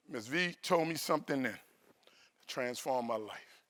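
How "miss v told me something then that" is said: ordinary talk, nothing marked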